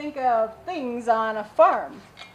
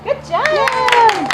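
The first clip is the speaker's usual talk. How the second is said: high, bright cheer; cheerful praise; 12 semitones higher, 7 dB louder, much slower than usual. Real, not acted. Praising kids after song.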